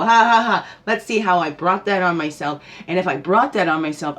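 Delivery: loud hearty mock laugh rolling into the words; laughing at yourself. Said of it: "laugh at ourselves then and go".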